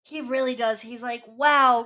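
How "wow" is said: loud, burst-out mock wow; hammed-up delighted gasp of a word; mock excited wow. Mock excited quote of Joe.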